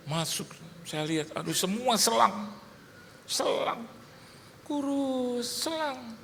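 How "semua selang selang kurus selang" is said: high, fading, pitying voice; hushed high lament, acted out; pained dismay. Father sees his thin baby full of tubes, pained.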